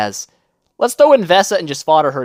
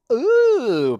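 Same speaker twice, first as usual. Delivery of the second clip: usual speaking voice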